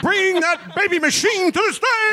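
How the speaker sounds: high shouted sleazy emcee; high crass shout. Acted. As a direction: hype the crowd like a sleazy host. Crass host character shouting.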